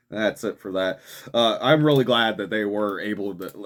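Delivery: ordinary talk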